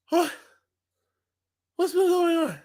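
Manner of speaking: ordinary talk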